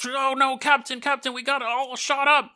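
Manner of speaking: hammed-up cry to the captain; panicked crewman. Crewman reporting damage to the captain, mock panicked. Acting panicked bomber crew under fire.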